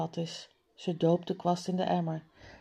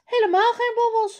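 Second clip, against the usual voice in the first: much higher and louder, offended; indignant shout; 7 dB louder, faster than usual. A narrator's reading. Mala shouts indignantly at her father, much higher and louder. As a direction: shout it back, cross and insulted. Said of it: "roept Mala boos".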